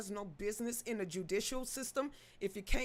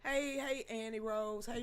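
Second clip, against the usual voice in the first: normal talking voice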